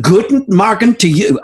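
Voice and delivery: self-admitted bad German accent; half-hearted German accent; German accent attempt. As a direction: echo the greeting in a weak German accent. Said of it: "I can't do a good German accent".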